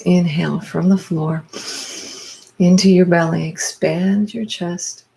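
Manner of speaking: slow, spaced-out breathing cues with drawn-out words; guided breathwork. Real, not acted. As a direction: lead the breath, pausing after each cue. Leads a guided breathing exercise.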